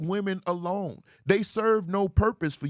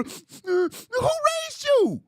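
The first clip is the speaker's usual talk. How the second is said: much higher, drawn-out mocking whine; mock him in a stretched whiny voice; 4 dB louder, much slower than usual. Mocking a crying man.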